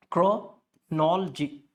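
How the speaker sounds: chronology slowly broken, kro... NOL... jee; slow drill of kro-NOL-jee for chronology; syllable-by-syllable CHRONOLOGY. Shown as a demonstration. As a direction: spell out chronology with strong "nol". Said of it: "Nol should be clear".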